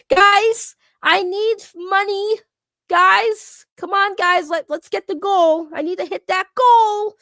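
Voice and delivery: high, loud mock begging for money; whiny hard-sell plea, hammed up; Locale and E-Bag. Streamer mimicking rivals pushing viewers for a donation goal, mock begging. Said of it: "let me be like Locale and E-Bag".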